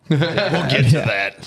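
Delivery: mocking. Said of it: "mocking repeat with laugh".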